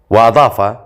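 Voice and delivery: ordinary delivery, nothing marked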